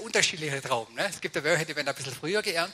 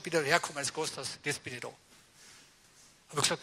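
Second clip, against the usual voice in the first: ordinary talk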